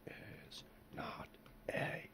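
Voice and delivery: slow, breathy whisper. Real, not acted. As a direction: whisper it slowly and softly. Whispered aside while sculpting.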